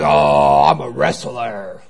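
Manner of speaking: very low, drawn-out wrestler impersonation; deep voice. Retold or imitated. Wrestler introducing himself to his seatmate, deep voice. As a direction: slowly put on a big wrestler's deep voice. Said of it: "in that deep voice".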